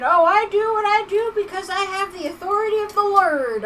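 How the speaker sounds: high, loud mocking of a smug preacher; mock pompous preacher. Streamer mocking the pompous man, loud and high. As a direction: sneer it like a self-important windbag. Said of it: "he's just there saying".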